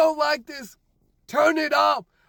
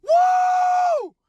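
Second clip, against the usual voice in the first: high, loud whoop of joy; joyful whoop; 4 dB louder than usual. Real, not acted. Celebrating a year sober on the ride home, big high whoop. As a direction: let out a big celebratory woo.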